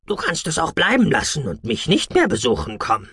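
leicht höher, locker neckend; Freundstimme. Acted. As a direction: ein Kollege, der lässig kontert. Freund Damalan gesprochen. (leicht verstellte, lockere Freundstimme)

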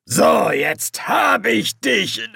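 high, slow, triumphant demon shout; throaty demon voice. A narrator's reading. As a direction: gloat like a demon who's won. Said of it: "Eine kehlige Stimme erklang".

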